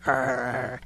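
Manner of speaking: low, soft imitated dog growl; attacking dog. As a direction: growl quietly like a dog pinning another. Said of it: "attacked Macy and put her down".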